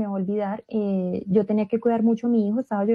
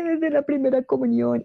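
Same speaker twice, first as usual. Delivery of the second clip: imitated tearful voice, pitched up; retold crying; 3 semitones higher than usual. Penitent answering the priest at confession, imitated crying. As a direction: retell it through a mock sob. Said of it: "yo empecé a llorar".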